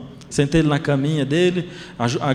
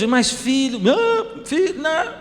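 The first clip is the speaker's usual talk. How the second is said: pleading; 12 semitones higher, slower than usual. Retold or imitated. Father pleading with crying son.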